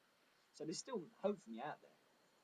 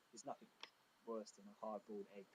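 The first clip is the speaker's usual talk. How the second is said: ordinary talk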